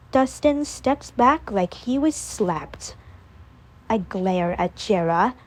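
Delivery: plain reading voice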